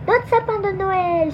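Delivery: high, drawn-out joyful exclamation; excited cry. A narrator's reading. Nina finds the Christmas tree in the clearing, excited cry. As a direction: cry out high, stretching the words. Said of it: "s'écria Nina".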